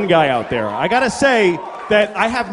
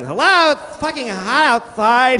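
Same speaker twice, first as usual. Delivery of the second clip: lightly voiced camp guy, slow and higher; a hint of a flamboyant guy calling out; camp street guy; 8 semitones higher, 4 dB louder, slower than usual. Flamboyant guy on the corner greets passersby, lightly camp. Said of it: "he's standing on the corner going".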